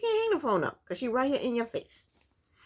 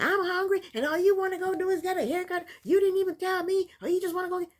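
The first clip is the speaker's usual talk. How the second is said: much higher, mocking whine; mocking whiny complaint; 10 semitones higher than usual. Vlogger mocking Kayla's complaint to Martell, higher whiny voice. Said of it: "He's like".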